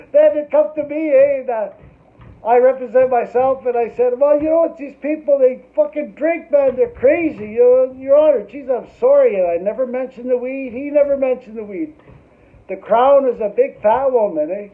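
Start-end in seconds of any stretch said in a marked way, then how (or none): 4.2-9.3 loud, higher rambling plea; excited pleading. Retold or imitated. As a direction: beg the judge in a rush. Pleading case before judge.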